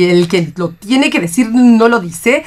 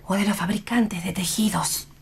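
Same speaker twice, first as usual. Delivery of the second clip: softer, mocking whisper; 9 dB quieter than usual. Mother whispers a jab about the father. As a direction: slip in a sly whispered jab.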